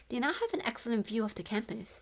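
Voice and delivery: ordinary delivery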